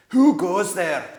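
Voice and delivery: high, loud animal snarl; snarling badgers. A narrator's reading. Sentinel badgers challenging strangers, snarling loud and high. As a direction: snap the challenge like a guard beast. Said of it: "asked them in snarling voices".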